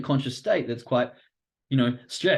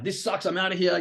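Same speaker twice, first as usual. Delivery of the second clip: higher-pitched, mildly acted rage quit; mock rage; 6 semitones higher than usual. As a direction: play at storming off, no extra volume. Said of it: "You rage".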